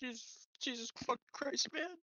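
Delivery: high, tearful cry of shock; a player voicing her character's grief, high and tearful. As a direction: break down in tears over the body. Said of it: "I'm, like, crying".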